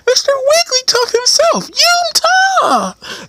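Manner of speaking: very high cartoon creature voice; squeaky mascot cry; Wigglytuff. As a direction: squeal the catchphrase high and long. Imitates Wigglytuff's catchphrase.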